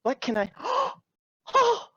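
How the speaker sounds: high breathless gasp of wonder; startled, awestruck fairy; gasping awe. Acted.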